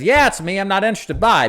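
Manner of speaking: high, loud acted brush-off; dismissive client. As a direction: cut the caller off as an uninterested customer. Acting a client cutting off agent.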